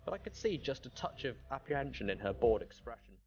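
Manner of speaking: usual speaking voice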